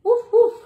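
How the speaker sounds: high, loud playful woof woof; dog bark. Acted. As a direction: bark like a cheerful little dog. Dog barks in picture book.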